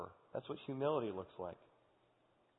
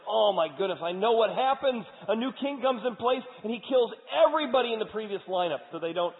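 high, loud panicked cry; frightened man expecting execution; panicked Mephibosheth; 10 semitones higher, 11 dB louder than usual. Acted. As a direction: voice him terrified of the new king. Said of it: "And Mephibosheth's like".

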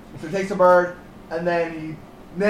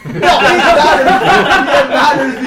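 high, loud, slow laughing protest; laughing insistence; 9 semitones higher, 12 dB louder, slower than usual. Real, not acted. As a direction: laugh it out while insisting it matters. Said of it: "insisting through laughter".

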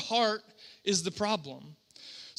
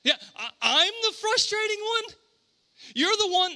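high, raised-voice incredulity; frustrated parent; 11 semitones higher, 5 dB louder than usual. Acted. Parent answering the blaming kid, high exasperated outburst. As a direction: fling it back, pitched way up in indignant disbelief. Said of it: "a parent being frustrated".

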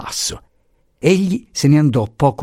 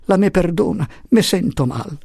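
high, weary apology; plaintive little man; 9 semitones higher than usual. A narrator's reading. Little man excuses himself feeling unwell, plaintively. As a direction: beg pardon feebly in a high voice.

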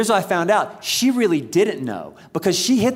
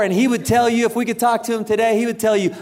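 ordinary talk

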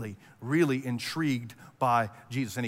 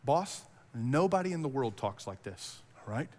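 low, soft, hesitant imitation; nervous; 3 semitones lower, 5 dB quieter than usual. Officers replying to the Pharisees, nervous. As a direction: timidly break it to the boss. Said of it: "probably nervously".

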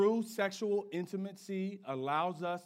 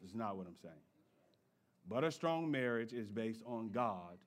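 ordinary talk, nothing marked

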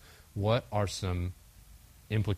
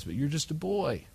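mocking dismissal; 6 semitones higher than usual. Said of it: "Saul laughs at him and says".

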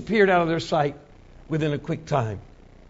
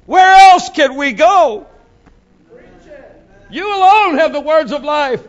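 louder and higher, slow, pleading; fervent plea; 10 semitones higher, 8 dB louder than usual. Acted. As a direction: cry out to Jesus with longing. Peter pleading to Jesus.

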